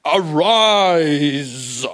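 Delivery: loud, booming mock "Arise"; grand mock command. Preacher mocking a lofty order to the dead girl. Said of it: "He doesn't walk in and say".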